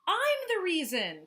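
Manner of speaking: high, loud happy call. Acted. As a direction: call out proudly and happily. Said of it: "called Moose happily".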